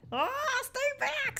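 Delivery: very high, drawn-out squeal; mock alarm. Streamer warding off chat in play, mock alarm. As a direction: squeal it in pretend panic, slowly.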